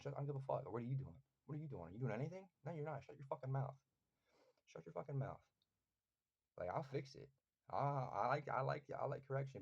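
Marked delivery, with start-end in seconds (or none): 0.0-5.4 higher-pitched, challenging comeback; defiant telling-off. Acted. Telling off those who would correct his form, defiant. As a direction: challenge the critics, then shut them down. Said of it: "I just tell them".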